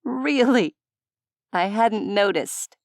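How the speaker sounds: high sarcastic Really?. A narrator's reading. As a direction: drip with dry sarcasm. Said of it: "She huffed a laugh".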